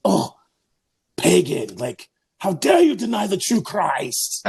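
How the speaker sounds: high, drawn-out mock indignation; mock righteous outrage. Priest imagining himself scolding a pagan, mock righteous outrage. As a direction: play the scandalized zealot, stretching the words. Acting out a hostile reaction he avoided.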